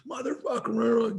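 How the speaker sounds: imitated high-pitched, drawn-out swearing outburst; erupting. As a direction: mimic a student erupting in a slow, high cry. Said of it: "And he erupts".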